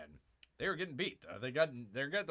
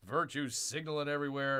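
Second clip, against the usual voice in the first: usual speaking voice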